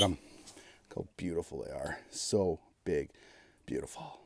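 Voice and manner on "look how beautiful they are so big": normal talking voice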